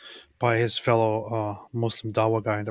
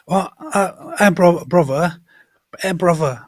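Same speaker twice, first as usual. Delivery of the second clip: put-on higher, much louder British street accent; 7 semitones higher, 11 dB louder than usual. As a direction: bark it like a British lad. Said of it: "And he's like".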